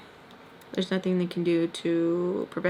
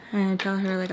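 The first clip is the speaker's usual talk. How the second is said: usual speaking voice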